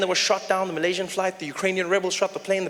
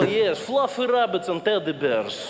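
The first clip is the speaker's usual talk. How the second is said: slow Russian menace on sweet words; menacing Russian accent; slower than usual. Acted. Comic shows Russians saying nice things, menacing Russian accent. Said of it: "it still sounds dangerous".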